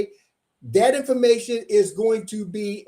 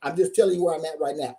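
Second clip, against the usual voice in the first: ordinary talk, nothing marked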